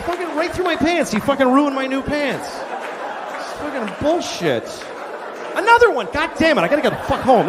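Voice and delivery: high-pitched, fed-up complaining; indignant victim rant. Acted. The same man at the ATM ranting on. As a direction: squeal about ruined new pants.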